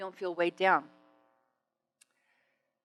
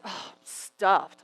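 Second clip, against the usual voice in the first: slow, drawn-out mock groan; overfull groan; much slower than usual. Man after steak and mousse answering, slow stuffed groan. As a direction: imitate a diner too full to move.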